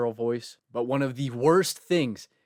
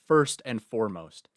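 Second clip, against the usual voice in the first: usual speaking voice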